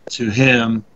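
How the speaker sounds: normal talking voice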